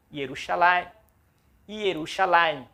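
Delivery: Yerushalayim slowly, ye-ru-sha-la-yim; Hebrew pronunciation. Shown as a demonstration. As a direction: say Yerushalayim slowly for students to repeat. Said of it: "a palavra Jerusalém". (Yerushalayim pronunciado devagar)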